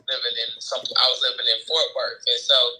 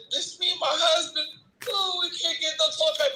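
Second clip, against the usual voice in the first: imitating upset coworker; 13 semitones higher than usual. Sad coworker voiced.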